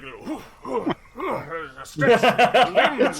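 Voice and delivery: put-on drawn-out old-master stretching noises; Master Roshi. Orvex shows companions how to exercise, drawn-out old-master voice. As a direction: groan through a limbering-up stretch, slowly. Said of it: "he's Master Roshi".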